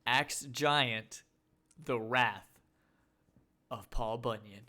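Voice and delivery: loud, deliberate, with big pauses; dramatic title announcement. Acted. Co-host repeats the absurd movie title, grandly. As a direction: announce it piece by piece like a trailer.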